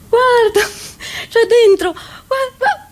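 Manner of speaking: tiny, high, stricken voice; high, pained little wail; high-pitched dismay. Acted. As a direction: squeak it out, appalled at what is inside. Peering at the packet in dismay.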